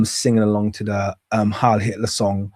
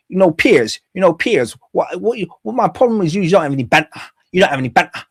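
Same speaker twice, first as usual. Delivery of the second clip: imitating Andrew Tate; 6 semitones higher, faster than usual. Host mimicking Andrew Tate lecturing Piers, higher mock American-English accent. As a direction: parody his flipping American-English accent, pitched up. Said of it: "he's talking like this".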